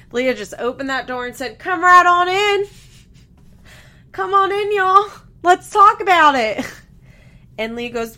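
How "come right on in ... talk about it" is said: high, loud holler; mock hollered welcome. Leah throwing open the door, hammed-up high holler. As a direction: call them all in, loud and high-pitched. Mimics Leah inviting film crew in.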